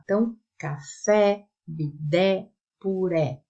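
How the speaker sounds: "café, bidé, puré" stressed on the final E; stress on last syllable. Shown as a demonstration. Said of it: "sílaba forte é na última". (acento na última sílaba de "café, bidé, puré")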